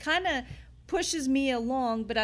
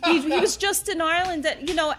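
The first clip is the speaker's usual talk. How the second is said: ordinary talk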